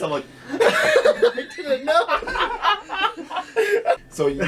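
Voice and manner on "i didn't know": slow, high, laughing. Real, not acted. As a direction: say it while cracking up. Laughing at the slip.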